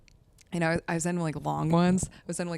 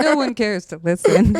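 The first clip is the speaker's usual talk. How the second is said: laughing; 5 semitones higher, 10 dB louder than usual. Retold or imitated. Mocking indifferent audience, laughing.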